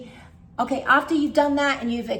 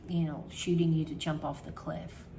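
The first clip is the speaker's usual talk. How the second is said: ordinary delivery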